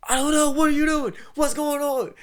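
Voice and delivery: high, loud, frantic shout back; acted-out panicked hollering; panicked yelling. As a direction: scream back in total confusion. Panicked exchange with screaming man, retold.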